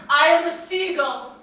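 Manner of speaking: loud distraught I am a seagull; wild cry. Acted. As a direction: cry it out half-mad. Nina's wild exclamation.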